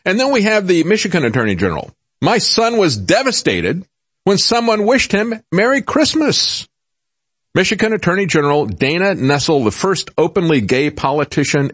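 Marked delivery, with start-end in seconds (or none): 2.2-6.7 mock whiny voice. Mocking the attorney general's tweet.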